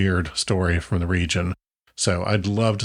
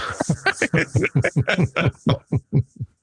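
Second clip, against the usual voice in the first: laugh; 7 semitones higher than usual. Real, not acted. Laughing at the joke.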